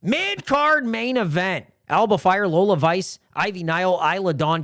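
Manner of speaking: high, drawn-out card announcement; match announcer. Pretend. Host announcing four wrestlers, ring announcer style. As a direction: stretch the title, then roll out each name.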